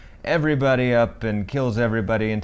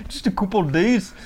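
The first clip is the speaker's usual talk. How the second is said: high-pitched hammed-up Scottish; squeaky caricature Scottish accent; exaggerated Scottish accent; 10 semitones higher than usual. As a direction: play up the Scottish slip, much higher. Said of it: "You just sounded Scottish a little bit".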